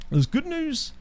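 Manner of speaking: high mock good news; squeaky mock announcement. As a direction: sing it out high and silly.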